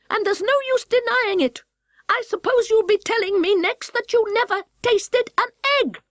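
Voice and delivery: high, accusing bird voice; high indignant pigeon voice; scolding pigeon. Acted.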